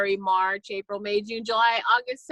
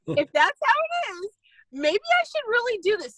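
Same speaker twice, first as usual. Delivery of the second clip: high laughing give-in; real laugh of surrender; 12 semitones higher than usual. Real, not acted. Laughing at the Lord's message.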